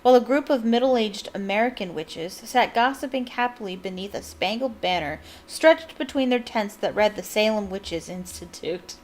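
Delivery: usual speaking voice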